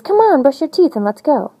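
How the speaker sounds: high, bright-eyed bird urging you up; eager birthday bird calling you to hurry; excited bird voice. Acted. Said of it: "Then the bird says".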